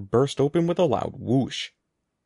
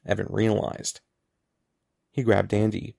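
ordinary delivery

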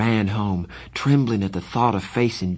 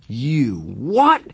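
louder, higher disbelieving shout; 4 semitones higher, 5 dB louder than usual. Acted. Darry shouting at Pony's excuse, angry disbelief. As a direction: bark it back in disbelief. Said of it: "He was shouting".

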